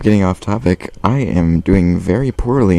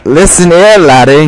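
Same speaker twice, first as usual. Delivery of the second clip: loud, high hammed-up Scottish accent; mock Scottish accent; 9 semitones higher, 10 dB louder, slower than usual. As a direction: bark it like a cartoon Scotsman. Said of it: "be Scottish there, laddie".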